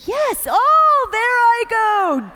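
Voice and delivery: a loud, high, slowed oh-no; reliving a fall. Retold or imitated. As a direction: cry out as you slip down the stairs. Falling down the stairs live.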